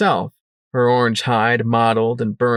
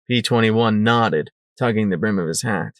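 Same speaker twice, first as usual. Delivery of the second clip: ordinary delivery, nothing marked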